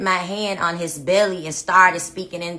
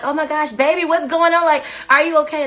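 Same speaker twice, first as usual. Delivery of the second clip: worried; 8 semitones higher, 4 dB louder, faster than usual. Retold or imitated. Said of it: "I'm like".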